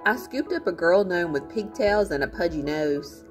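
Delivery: ordinary delivery, nothing marked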